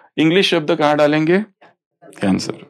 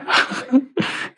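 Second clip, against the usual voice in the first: laugh; 11 semitones higher, 6 dB quieter than usual. Real, not acted. Laughs at muddled brains.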